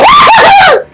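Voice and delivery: high, loud hammed-up scary cackle; mock monster cackle. Scaring the game monsters back, hammed-up high loud cackle. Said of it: "Ahora yo espanto a los monstruos".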